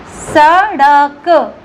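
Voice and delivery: सड़क drawn out very slowly, flapped ड़ in the middle; slow demo word. Shown as a demonstration. Teacher modeling the word for children: very slow सड़क. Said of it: "जैसे हो गया".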